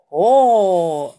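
"oh" fuerte y alargado; exclamación de asombro. A narrator's reading. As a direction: asombro de grupo, actuado. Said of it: "exclaman todos". (exclama admirado, alargando la vocal)

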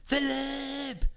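high, drawn-out call from upstairs; calling his name loudly. Retold or imitated. Wife calling husband from upstairs, long high call. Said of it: "sie ruft ganz laut".

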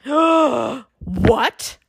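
an outraged tiny king; roaring king. A narrator's reading. Fairy king roaring at Jack, mock roar. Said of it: "roared the High King".

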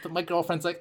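usual speaking voice

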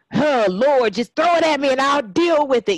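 reliving the moment she begged God to take it instead; retold plea to God.

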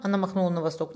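ordinary delivery, nothing marked

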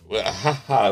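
usual speaking voice

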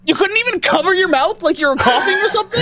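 high, loud teasing shout; mock-scold him, laughing; playful scolding. Real, not acted.

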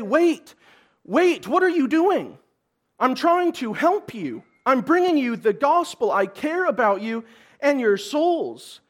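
mock panic of a man about to die, begging; panicked plea. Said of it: "He doesn't say".

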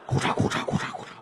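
train noise. Retold or imitated. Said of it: "火车的声音嘛".

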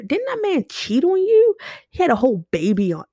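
a mocking, sky-high rhetorical dig; incredulous jab. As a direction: pitch shoots way up in mock disbelief. Mocking the detractors' past words.

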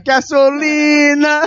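slow, stretched callback; drawn-out gasolina. Pretend. Friend drawls gasolina as a callback. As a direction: stretch gasolina out slowly as a joke.

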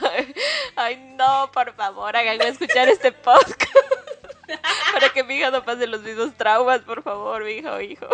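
loud plea dissolving into laughter; laughing plea. Real, not acted. Told she will parent likewise, laughing plea. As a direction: beg through real laughter.

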